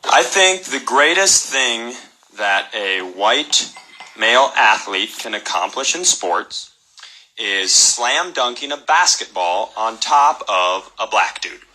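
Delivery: deadpan delivery. Acted. Deadpan comedian holds low drone.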